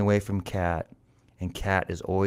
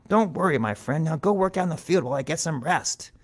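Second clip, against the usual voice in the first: lightly voiced higher mouse; reassuring mouse; 8 semitones higher than usual. A narrator's reading. Mouse soothes Cat to get rid of him, higher mock reassurance. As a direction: send him off with singsong reassurance.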